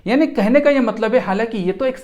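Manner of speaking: ordinary delivery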